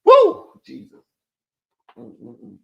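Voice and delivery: fighting off a sneeze; words strained by a held sneeze; holding back a sneeze. Real, not acted. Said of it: "I'm trying not to sneeze".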